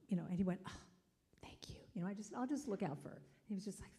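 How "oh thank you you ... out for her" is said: whisper. Retold or imitated. Boy whispering back reply.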